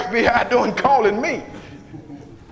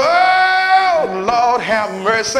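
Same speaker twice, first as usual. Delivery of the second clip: preaching whoop; 3 dB louder, much slower than usual. Acted. Preacher whooping to the congregation about giving. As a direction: moan it out like a preacher.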